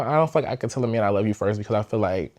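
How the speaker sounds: ordinary talk, nothing marked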